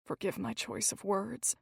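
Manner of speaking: whispered. A narrator's reading. Gilbert to Hazel, apologizing close beside her, in a whisper. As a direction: breathe the apology softly into the quiet. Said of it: "he whispered".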